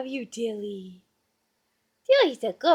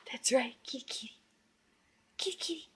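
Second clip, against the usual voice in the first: hushed whisper, lower pitch; 3 semitones lower, 14 dB quieter, much faster than usual. Real, not acted. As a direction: whisper softly to encourage. Whispered coaxing after gasp.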